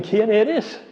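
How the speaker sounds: tiny high voice, asking timidly; vocecita. Retold or imitated. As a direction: ask it in a small squeaky voice. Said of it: "con una vocecita". (Saulo pregunta con una vocecita aguda)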